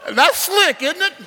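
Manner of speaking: high, loud laughing quip; delighted laughing aside. Real, not acted. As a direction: crack the joke through a laugh. Joking with the congregation.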